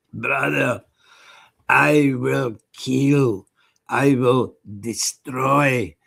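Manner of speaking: slow, deliberate, heavy vow; measured tough-guy vow, spaced out; impression of friend. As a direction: intone each threat slowly like a grim fighter. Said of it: "That's quite a good impression of him".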